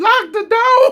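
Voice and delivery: squeaky, high laugh through the words; can barely get it out for laughing. Real, not acted. Host retelling the mom's line to viewers, laughing hard.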